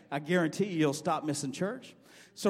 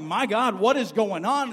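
startled, louder and higher retelling of his panic; alarmed cry; 5 semitones higher, 8 dB louder than usual. Retold or imitated. Missionary jolted awake by screams calls out in alarm.

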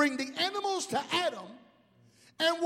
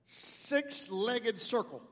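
stumped naming; 5 semitones lower, 7 dB quieter, slower than usual. Pretend. Imagines himself naming animals, stumped.

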